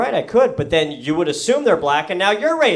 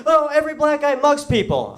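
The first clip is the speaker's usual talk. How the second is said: pitched way up, sneering caricature; high voice mocking a prejudiced generalization; mock bigot voice; 10 semitones higher than usual. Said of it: "I'm not going around being like".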